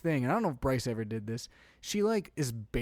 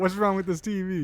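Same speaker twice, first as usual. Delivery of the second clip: lightly higher, louder puzzled grumble; mock mad dad; 6 semitones higher, 5 dB louder than usual. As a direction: play it as a confused dad poking the TV. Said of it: "You probably mad, like".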